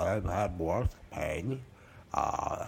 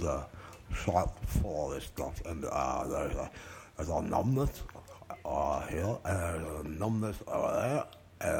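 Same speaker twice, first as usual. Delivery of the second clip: ordinary delivery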